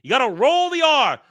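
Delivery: loud, high exasperated yell. Real, not acted. Reactor correcting a kid's ricotta, yelling. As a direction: holler the correction in outrage. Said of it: "I'm yelling at a kid".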